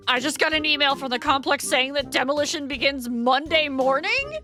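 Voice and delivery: high-pitched, indignant questioning; incredulous protest. Acted. Tenant challenges landlord over demolition date, incredulous protest. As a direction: challenge him, voice climbing in disbelief.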